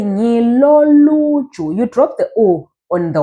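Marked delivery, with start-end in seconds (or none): 0.0-1.7 "ngilo luju" slow, higher, drawn out; slow teacher's demo of "ngilo luju"; model pronunciation. Shown as a demonstration. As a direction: stretch "ngilo luju" with raised pitch. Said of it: "So you say".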